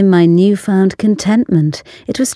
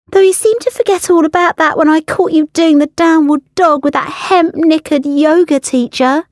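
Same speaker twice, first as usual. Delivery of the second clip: much higher, faster, stung comeback; jealous retort; 10 semitones higher than usual. Acted. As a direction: throw it back at him, hurt. Ella retorts at ex.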